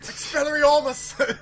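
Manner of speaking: very high, loud, drawn-out spell cry; Harry Potter parody. Pretend. Answering co-host's YouTube poop jibe, absurd Harry Potter shriek. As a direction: shriek the spell in a silly stretched voice. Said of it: "sound like a freaking Harry Potter".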